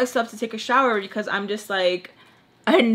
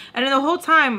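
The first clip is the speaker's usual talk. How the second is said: usual speaking voice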